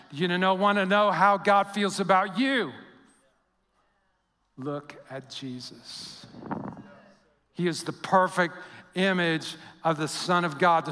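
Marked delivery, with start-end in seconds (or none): 4.6-6.3 much softer and slow, hushed; soft slow answer. Acted. Preacher closing the refrain, slow and hushed. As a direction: linger over it, hushed.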